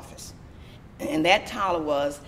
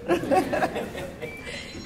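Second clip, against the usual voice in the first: breathy, high laugh; real laugh; 11 semitones higher than usual. Real, not acted. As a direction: laugh off the misunderstanding. Laughs after the punchline.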